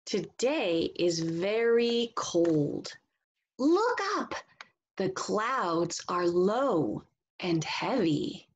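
slow, sing-song storybook reading; reading to children. Acted. Teacher reading a picture book to the class, slow and expressive. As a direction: read it slowly and expressively for young listeners.

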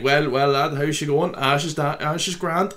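broad friendly Irish chat; Irish accent. Retold or imitated. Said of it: "And I'm like".

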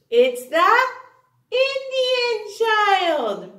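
put-on sing-song rhyme for children; poem recitation. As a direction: recite it with a bouncing beat.

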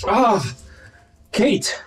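swooning lover, high and loud; dramatic declaration. Acted. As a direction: declare undying love theatrically.

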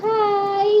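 put-on speaking for the baby. Mother says hi to viewers on her baby's behalf, lightly voiced baby hi. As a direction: greet them as if the baby said it. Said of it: "আমাদের বাবুসোনার পক্ষ থেকে আমি বলে দিচ্ছি".

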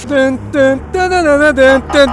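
slow mouth siren sound; siren imitation. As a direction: sing out a police siren.

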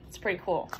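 ordinary delivery, nothing marked

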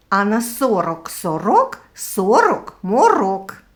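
tongue twister with the R in "горобець", "гороху" rolled hard; clearly sounded R. Shown as a demonstration. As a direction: stress and trill every R, as in "сороку", "морока". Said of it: "щоб було чути звук Р".

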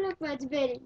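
ordinary delivery

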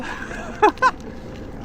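high airy giggle; breathless laugh. Real, not acted. Rider laughing at the cowardly dog, airy giggle. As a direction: laugh it off under your breath.

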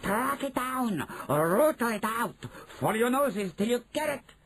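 high, stretched witch commands; screamed orders. Acted. Grand High Witch drives the hunt, high screeching. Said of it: "screamed the Grand High Witch".

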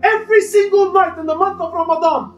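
high, urgent sermon voice with rising passion; impassioned preaching. Acted. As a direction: preach it with full force to the congregation. Preacher building emotional Ramadan appeal.

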